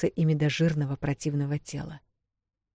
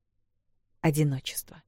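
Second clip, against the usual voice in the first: hushed whisper; 5 dB quieter than usual. A narrator's reading. Narrator whispering the orc's loneliness. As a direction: whisper the word out, breath only.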